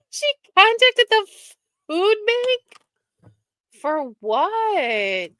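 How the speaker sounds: high, loud, stunned exclamation; can't believe what you just read; outraged high-pitched shock; shocked disbelief. Real, not acted. Shocked by news about Jane.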